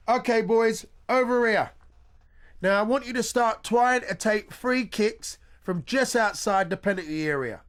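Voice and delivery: high, loud sports-teacher call; coach calling out. Acted. Coach calling the boys over to practise free kicks. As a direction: shout instructions across the football pitch.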